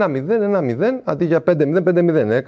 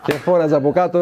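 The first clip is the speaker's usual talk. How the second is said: usual speaking voice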